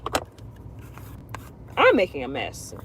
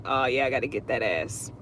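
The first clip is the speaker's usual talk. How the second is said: low, soft muttered threat; lightly voiced grudge, under the breath; a hint of a quiet vow of revenge; mock vengeful Taurus; 8 semitones lower, 7 dB quieter than usual.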